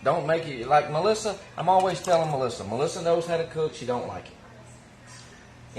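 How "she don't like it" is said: whispered aside. Real, not acted. Teasing aside about Melissa.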